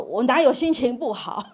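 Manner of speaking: hammed-up loud protest, breaking into a laugh; loud denial. Child denying a bad mood to parent, loud indignant denial. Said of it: "声音变很大".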